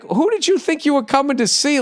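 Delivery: high, mock-incredulous question; incredulous retort. Comic retelling his reply to the heckler, high and incredulous. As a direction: ask it like the answer is obvious. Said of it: "I was kinda like".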